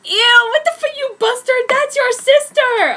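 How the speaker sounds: high, loud hammed-up outrage; mock explosion. Narrator finding Edward with his sister, loud mock outrage. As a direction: blow up in shrill fake fury. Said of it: "I exploded".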